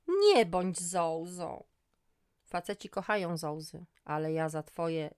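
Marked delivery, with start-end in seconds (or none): 0.1-1.6 slow, louder pleading. Acted. Ex almost begs her on the phone. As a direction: beg her, dragging it. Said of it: "Prawie błagał".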